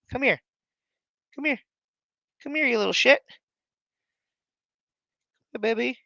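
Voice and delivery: high, soft pet talk; coaxing the cat. Real, not acted. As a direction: plead sweetly with a stubborn cat. Coaxing cat onto camera.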